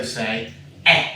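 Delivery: ea said as a short eh; short eh sound. Shown as a demonstration. Teacher modelling the eh sound for a child. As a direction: say "eh" clearly as in head. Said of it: "like words like head".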